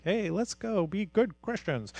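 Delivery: soft, half-hearted cheer; rally them feebly, as a deliberately weak example; quiet mock pep talk. Shown as a demonstration. Coach feebly urging people to be good Christians, quiet. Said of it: "it's not this quiet".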